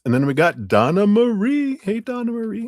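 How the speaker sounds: bright, high sing-song hello; excited greeting. Real, not acted. Greeting the next donor.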